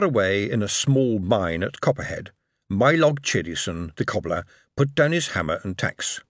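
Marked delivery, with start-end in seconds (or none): none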